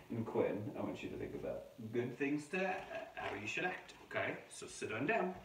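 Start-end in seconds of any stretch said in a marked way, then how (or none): none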